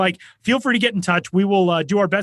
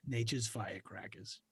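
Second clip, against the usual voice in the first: Boston ad voice; 6 semitones lower, 19 dB quieter than usual. Retold or imitated. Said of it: "Sam Adams commercials".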